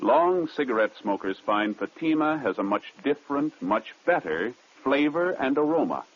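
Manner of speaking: polished, emphatic sales pitch; radio ad read. Acted. Announcer praising cigarette flavor, ad read. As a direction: stress the selling words with lilting pitch.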